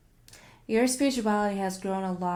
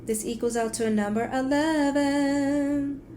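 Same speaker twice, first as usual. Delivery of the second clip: excited reveal; 6 semitones higher, 7 dB louder than usual. Real, not acted. Reading tarot numbers excitedly.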